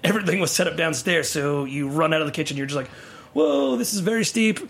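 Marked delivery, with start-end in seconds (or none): 3.3-4.6 high-pitched mock "whoa" of alarm; retold alarm. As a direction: act out dread at a steep drop. Retold reaction to steep stairs.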